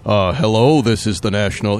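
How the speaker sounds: low, official-sounding phone pitch; mock cop voice. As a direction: imitate a con artist posing as a policeman. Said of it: "tries to sound like a cop".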